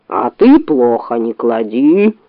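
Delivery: low, slow bear voice. A narrator's reading. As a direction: drawl it lazily. Bear answers.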